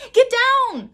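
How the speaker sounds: high, loud urgent shout. A narrator's reading. Girl shouting at her brother to get down. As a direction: yell a warning to duck. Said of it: "shouted Annie".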